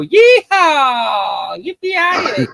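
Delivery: very high, drawn-out cowboy holler; mock cowboy whooping. Answering a request for a Boston accent, mock cowboy whooping. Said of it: "Ask me for a Boston accent, and I go".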